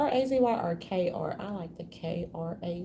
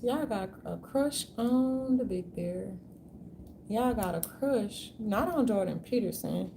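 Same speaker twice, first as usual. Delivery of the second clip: normal talking voice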